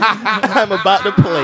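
loud, high, drawn-out teasing; laughing mock shout. Teasing friend over the shared cigarette, laughing mock shout. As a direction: shout it slowly through laughter.

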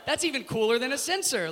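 high, loud wide-eyed enthusiasm; gullible delight. Retold or imitated. Tourist impressed by voice-activated doors, gullibly delighted. Retells falling for the joke.

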